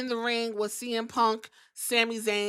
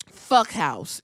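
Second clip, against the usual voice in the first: ordinary talk, nothing marked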